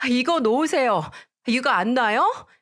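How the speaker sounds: yank free and yell; wife shouting at mother-in-law gripping her hair; struggling shout. A narrator's reading. Hair pulled, fighting back.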